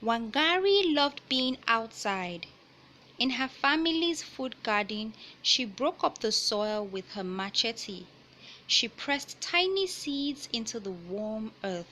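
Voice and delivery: picture book read-aloud voice; reading to children. Acted. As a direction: clear storybook narration.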